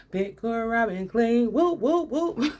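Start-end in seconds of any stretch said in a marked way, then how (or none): 1.5-2.6 high, laughing whoop whoop chant; excited whooping. Retold or imitated. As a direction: cheer it like a hype chant. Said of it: "that's what I was like".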